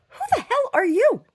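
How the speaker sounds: high, slow incredulous retort; offended disbelief. Retold or imitated. Retorting to a rude stranger, high and incredulous. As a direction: act stunned and insulted. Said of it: "And I'm just like".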